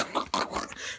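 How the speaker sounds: soft, voiceless rasping noise; mouth-made squelch effect; imitated grabbing. Bullets grabbing your organ, imitated squelching.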